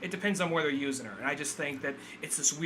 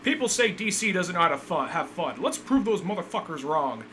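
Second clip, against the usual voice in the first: mock studio boast; 2 semitones higher, 4 dB louder than usual. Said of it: "Like, let's just".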